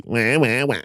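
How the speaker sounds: mock baby wail; whiny baby cries; imitating baby cry. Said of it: "Like the baby".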